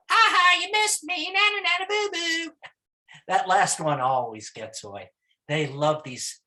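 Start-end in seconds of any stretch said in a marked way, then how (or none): 0.1-2.5 very high, squeaky singsong gloating; tricky monkey taunt. Acted. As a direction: crow it in a tiny monkey voice. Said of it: "He's very tricky and very clever".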